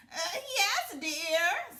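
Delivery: high, loud, scratchy put-on granny; the wolf faking a sweet old grandmother, scratchy; wolf pretending to be grandmother answers from bed, high and scratchy. A narrator's reading. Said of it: "said a scratchy voice".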